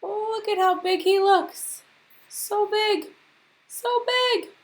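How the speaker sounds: high, doting coo over a pet; cooing Angela. Acted. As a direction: gush high like a doting owner. Angela cooing over her bird.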